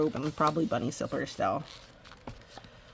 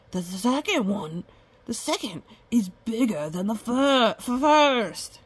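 stammering blacksmith; 3 semitones higher than usual. A narrator's reading. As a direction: trip over the last word, voice rising. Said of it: "stammered the blacksmith".

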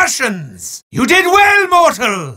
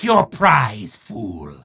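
lower, slow menacing repeat, fool dropping away; low sinister taunt; 12 semitones lower, 4 dB quieter, much slower than usual. Acted. Demon repeating the taunt to the winner, low and slow. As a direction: drag it down slow like a growling threat.

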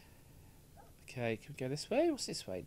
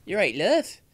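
louder mock mum calling to her girl; a mum's caring call, hammed up louder; louder imitating mum; 3 semitones higher, 9 dB louder than usual. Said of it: "Why didn't her mum fucking say".

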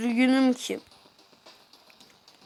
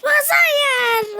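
high wailing no; crying shout; 4 dB louder than usual. Real, not acted. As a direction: wail it out in despair. Losing the game.

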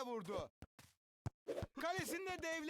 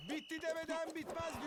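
ordinary delivery

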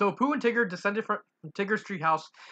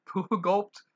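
plain reading voice

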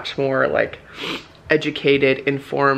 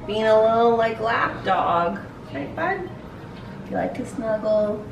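high, sweet pet voice; 6 semitones higher than usual. Real, not acted. Owner cooing about her snuggly dog in pet voice. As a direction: coo it like to a puppy.

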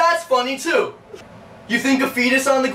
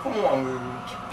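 very low, crooning come-on; deep, sleazy sweet-talk; seductive come-on; 10 semitones lower, 4 dB quieter than usual. Acted. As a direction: drop low and coax suggestively. Man turns seductive on the phone.

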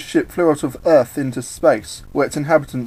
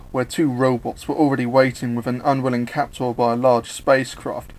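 ordinary delivery, nothing marked

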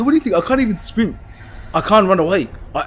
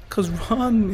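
usual speaking voice

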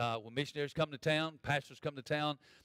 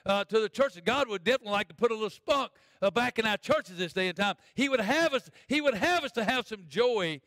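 raised, driving pulpit delivery; preaching; 5 semitones higher, 5 dB louder than usual. Real, not acted. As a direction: urge the congregation with force. Preaches at full force.

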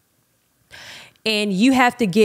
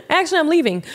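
quick high laugh through the word; laughing repeat; 8 semitones higher, faster than usual. Retold or imitated. Retelling her exit line to listeners, laughing and high. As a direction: laugh as you say it again.

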